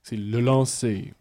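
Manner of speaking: slow, hushed mock commentator; mock play-by-play, drawn out; baseball announcer. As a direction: drag the call out softly like an announcer. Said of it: "il annonce ce que tu vois".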